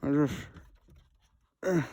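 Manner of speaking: talking with phone in mouth; muffled through a bitten phone; phone in teeth. Real, not acted. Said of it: "holding the phone with my mouth".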